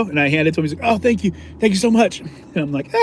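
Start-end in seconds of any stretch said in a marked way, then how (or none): 0.8-2.2 high-pitched imitation of relieved thanks; mock-grateful, high and effusive; grateful victim. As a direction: gush thanks in a raised voice.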